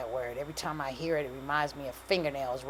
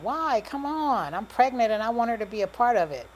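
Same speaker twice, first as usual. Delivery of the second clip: high, louder, insistent plea; pleading protest; 7 semitones higher, 6 dB louder than usual. A narrator's reading. Pregnant woman begging her baby's father to visit his mom, pleading. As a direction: push back, voice climbing.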